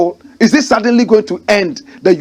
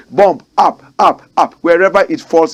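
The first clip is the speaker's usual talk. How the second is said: ordinary talk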